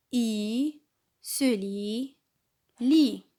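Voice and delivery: teacher sounding out syllables for the class; paced classroom sounding-out; syllable drill. Shown as a demonstration. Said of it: "on va lire la lettre L avec les voyelles ensemble".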